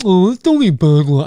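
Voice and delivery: put-on goofy sneering voice; mock it in a silly, altered voice. Shown as a demonstration. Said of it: "I don't say it like that".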